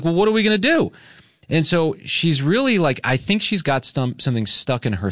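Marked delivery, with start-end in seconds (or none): 0.0-0.9 retold panic about what to do; panicked plea. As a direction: beg for a plan in alarm. Panicking over choking dog.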